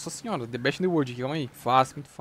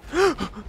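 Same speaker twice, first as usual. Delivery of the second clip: sharp gasp; 11 semitones higher than usual. Real, not acted. Gamer startled by sudden attack.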